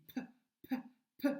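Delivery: "P" as a short consonant sound, not the letter name; son de lettre isolé. Shown as a demonstration. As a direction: repeat "P" in detached bursts. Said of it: "La lettre qui fait le son P". (le son « P » bref et détaché)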